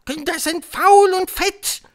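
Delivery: very high, loud, slow witch screech; screaming Grand High Witch. A narrator's reading. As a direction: scream it out, stretching the words. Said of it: "schrie die Hoch". (schreiende Hexe, gedehnt und schrill)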